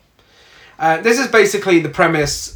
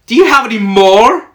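high, loud, eager fake American plea; bad American accent; 7 semitones higher, 7 dB louder than usual. Sister imitating Amber begging Carly for more, bad American accent. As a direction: beg for more in a clumsy put-on American accent. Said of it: "trying to do an American accent".

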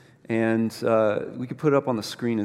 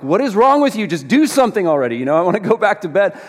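loud, higher-pitched retold outburst; exasperated anger; 5 semitones higher, 5 dB louder, faster than usual. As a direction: snap impatiently, reenacting being fed up. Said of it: "I was so angry".

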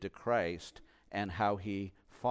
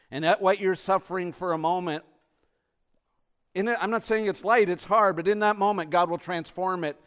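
loud, raised, pressing sermon delivery; full-force preaching; 7 semitones higher, 12 dB louder than usual. Real, not acted. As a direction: preach with urgent conviction.

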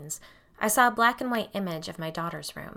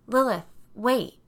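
called out, slowed and louder; calling after her; 5 semitones higher, 5 dB louder, slower than usual. A narrator's reading. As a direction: call her back as she leaves. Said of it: "I called after her".

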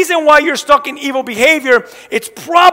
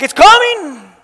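exasperated parent; 5 semitones higher, slower than usual. Retold or imitated. Dad answering his daughter from the kitchen, exasperated call. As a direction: call back, fed up. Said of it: "I'm like".

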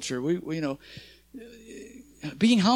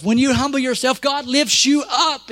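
preaching shout; 5 semitones higher, 3 dB louder than usual. Real, not acted.

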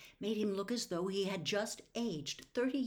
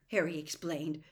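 ordinary delivery

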